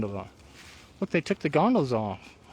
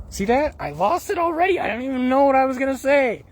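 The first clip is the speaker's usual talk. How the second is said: high, raised-voice exasperation; exasperated outburst; 8 semitones higher, 5 dB louder than usual. Real, not acted. Host losing the thread of the intro, exasperated.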